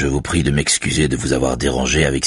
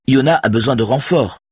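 much higher, louder urgent plea; almost crying out; 11 semitones higher, 4 dB louder than usual. A narrator's reading. Saga pleading with Carlos for backup, almost crying out. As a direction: nearly shout it to push back. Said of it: "s'écrie presque Saga".

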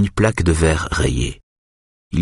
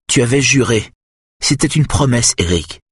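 upset voice; 8 semitones higher than usual. A narrator's reading. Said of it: "dit-elle d'une voix bouleversée".

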